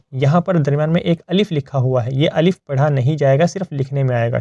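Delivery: ordinary delivery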